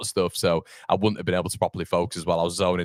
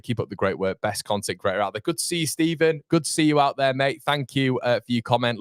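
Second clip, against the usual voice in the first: usual speaking voice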